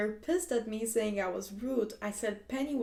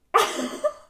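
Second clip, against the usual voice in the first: loud, high burst of real laughter; 3 semitones higher, 7 dB louder than usual. Real, not acted. Reacting to a sibling's harsh jab, shocked laugh.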